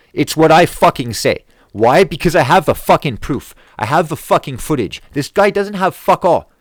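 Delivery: loud, high, emphatic outrage; indignant insistence. Real, not acted. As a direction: hammer each point home, fed up. Insists his footage proves it.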